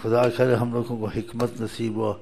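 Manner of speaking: usual speaking voice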